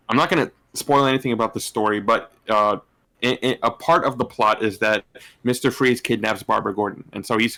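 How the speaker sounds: ordinary delivery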